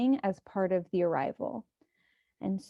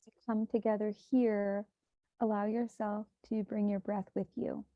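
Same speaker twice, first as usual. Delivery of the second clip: softer, unhurried meditation voice with long pauses; hushed, even meditation guidance; guided meditation; 4 dB quieter than usual. Acted. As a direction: guide listeners gently into breath and body.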